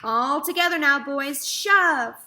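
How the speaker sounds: loud, effortful farmer's shout; rally the men to heave; loud rallying call. A narrator's reading. Farmer urging men to push.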